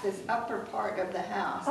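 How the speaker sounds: normal talking voice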